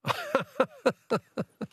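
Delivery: high-pitched, wheezy burst of giggles; real high giggling fit; amused laugh. Real, not acted. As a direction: laugh helplessly at the caller's remark. Amused by caller's monkey moat remark.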